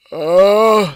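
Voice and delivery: loud, high, open-mouthed yawn; big yawn. Real, not acted. Interrupting his own story, loud yawn. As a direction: let a long yawn out on "oh". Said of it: "sorry, yawns".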